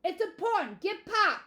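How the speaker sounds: yelling urgently, high and loud. A narrator's reading. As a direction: shout to rouse someone in another room. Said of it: "he yelled, followed by a long pause".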